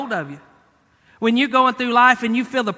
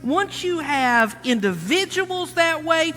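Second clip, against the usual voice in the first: higher, stretched-out pulpit phrases; building preaching; 6 semitones higher, slower than usual. Real, not acted. Preacher explaining discouragement to the congregation, building emphatic preaching. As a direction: draw out each step toward the point.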